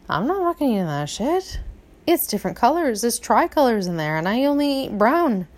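high, swooping cat's complaint; fussy cat. Acted. Cat refusing cheap kibble to its owners, high and fussy. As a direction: play a picky cat refusing dinner. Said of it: "he looked at us and he's like".

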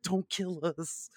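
slow, lightly voiced pleading Mark Wahlberg; Mark Wahlberg impression. The GM doing Mark Wahlberg begging the plants, slow and soft. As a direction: beg slowly, a hint of panic. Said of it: "that's my Mark Wahlberg".

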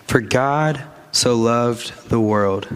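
x0.5 slow, every word set apart; slow emphatic recitation; slow, weighty repeat. Shown as a demonstration. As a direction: lean on each word of "For God so loved the world". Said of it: "repeated slowly for emphasis".

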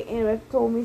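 ordinary delivery

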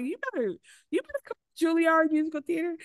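high-pitched impressed hype; mock cheering on. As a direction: playfully egg on a performer, voice way up. Acts out her cheering on the performer.

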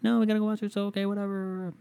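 high exasperated mock whine; annoyed boyfriend. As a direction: roll your eyes in a high put-on voice. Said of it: "I'm like".

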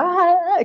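sudden high-pitched mock scream of pain; a short, loud, high acted cry; imitated scream. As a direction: yelp it like a burning sting. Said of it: "empieza a gritar así".

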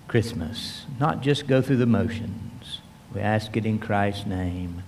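soft, low prayer voice; hushed pastoral prayer. Real, not acted. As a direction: pray quietly to the Father. Leads a prayer.

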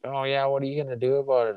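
fast, mocking old-guy sneer; old guy taunting. Old neighbor taunting the narrator, quick mocking voice. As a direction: sneer back like a bully. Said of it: "he's like".

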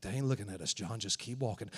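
soft, low reassurance to John; quiet urgent murmur; hushed urging. Retold or imitated. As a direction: keep him calm without being heard. Sneaking past gunmen.

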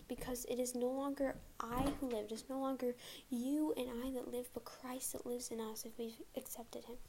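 usual speaking voice

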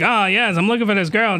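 usual speaking voice

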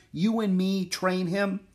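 high, slow disbelieving question; incredulous. Acted. Jack questions Annie's plan to train the horse, incredulous. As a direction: draw it out, high and doubtful. Boy doubts friend's plan.

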